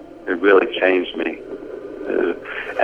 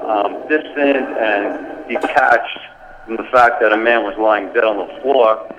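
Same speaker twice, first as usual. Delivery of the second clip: ordinary delivery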